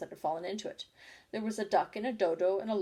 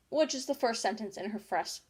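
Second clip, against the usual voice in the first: ordinary delivery, nothing marked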